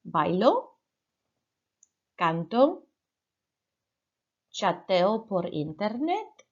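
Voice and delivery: clear, spaced Spanish model phrases; model Spanish for repeating. Shown as a demonstration. Teacher modelling phrases for the class to repeat, clear and spaced. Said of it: "repeat after me".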